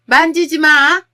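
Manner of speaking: loud, high-pitched shout to stop someone. Acted. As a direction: cry out a sudden, urgent command from behind. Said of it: "소리를 치면서 말이죠".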